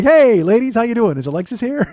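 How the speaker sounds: loud, high, overeager greeting; hammed-up attempt at a classy hello; trying to be classy. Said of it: "I'm still trying to be classy".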